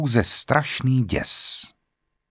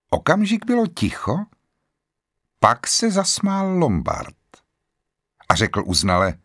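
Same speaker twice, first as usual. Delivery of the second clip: plain reading voice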